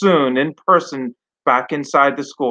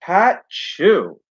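put-on lively storybook read-aloud for kids; reading to children. A narrator's reading. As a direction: tell it like bedtime story to a class. Teacher reads picture book aloud.

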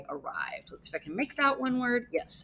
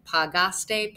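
usual speaking voice

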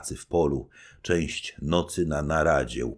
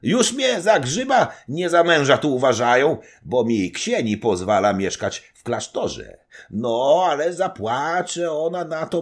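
higher, louder, laughing braggart voice; boisterous old nobleman; 10 semitones higher, 6 dB louder than usual. Acted. Old nobleman telling the knight about his wife, loud and gleeful. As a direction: roar out the gossip with glee.

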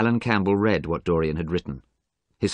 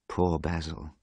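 inner thought; 2 semitones lower, 7 dB quieter, slower than usual. A narrator's reading. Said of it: "he thought".

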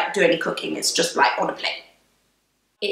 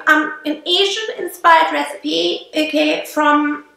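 normal talking voice

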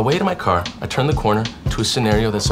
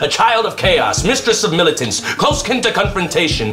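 raised, authoritative mock English accent; 4 semitones higher than usual. Poet defying the famous old writer on the sidewalk, authoritative English accent. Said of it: "it's in an English accent".

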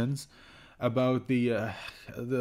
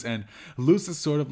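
ordinary delivery

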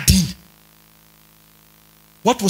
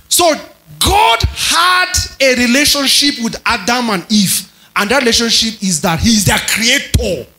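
raised, ringing preacher's voice; preaching; 6 semitones higher, 3 dB louder than usual. Acted. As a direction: drive the teaching home to the congregation. Preaches at full force.

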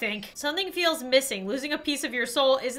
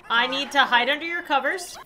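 ordinary delivery

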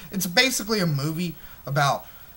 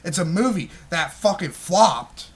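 ordinary talk, nothing marked

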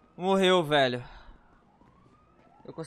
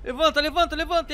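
higher, pressing repetition; urgent; 6 semitones higher than usual. Real, not acted. Gamer urging his fallen character to get up, urgent. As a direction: urge him up fast.

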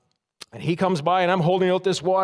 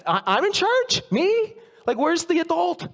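high, incredulous squeak; new parent realising they're in charge, panicky; 10 semitones higher than usual. Retold or imitated.

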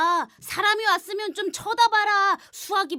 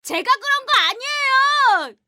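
higher, louder denial; loud protest; 7 semitones higher, 8 dB louder than usual. Acted. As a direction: protest your innocence loudly. Accused boy protests.

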